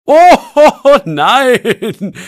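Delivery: laughing through the words, high and loud. A narrator's reading. Herr Bernheimer laughing at the boys. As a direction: laugh it out amused. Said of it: "lacht Herr Bernheimer".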